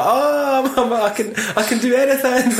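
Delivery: high, gloating mock boast with a laugh; gloating billionaire. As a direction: crow like a rich man who can buy anything. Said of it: "he's like that".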